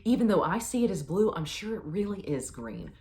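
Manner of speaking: a lightly hammed-up devoted yes-woman; adoring agreement. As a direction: agree with her like a starstruck fan. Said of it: "I would say".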